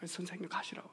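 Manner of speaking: urgent soft whisper; whispered urging. Retold or imitated. Preacher whispers to the driver to just leave, urgent whisper. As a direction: whisper him away, urgently.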